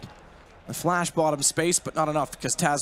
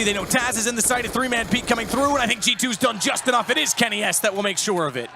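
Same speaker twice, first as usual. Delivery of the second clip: esports caster; 8 semitones higher, 3 dB louder than usual. Real, not acted. Live play-by-play casting climax.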